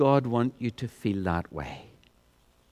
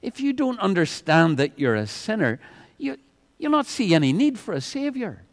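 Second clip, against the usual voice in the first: usual speaking voice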